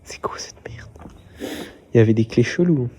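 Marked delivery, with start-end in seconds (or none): 0.0-0.9 whispered under the breath; hushed disbelieving whisper. Real, not acted. Hushed reaction.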